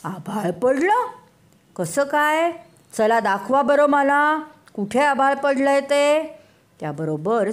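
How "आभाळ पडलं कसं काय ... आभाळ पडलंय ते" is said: slightly raised, doubting royal voice; a hint of a skeptical king demanding proof; lightly voiced lion king. Lion king doubting the animals, mild commanding tone. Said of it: "सिंह महाराज म्हणाले".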